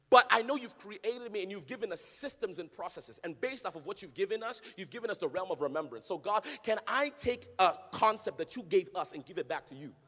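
ordinary talk, nothing marked

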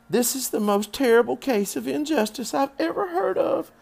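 very high, loud protest; high-pitched childish outrage; outraged little girl. A narrator's reading. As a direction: declaim it like an indignant child. Fern protests to her father.

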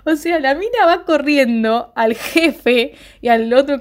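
words squeezed through laughter, high and slow; laughing while talking. Real, not acted. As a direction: keep laughing as you speak.

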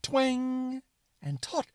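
high, drawn-out twang; springy twang. Shown as a demonstration. Narrator demonstrating the suspenders' sound, high drawn-out twang. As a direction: stretch it out like a plucked elastic strap. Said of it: "who go twang".

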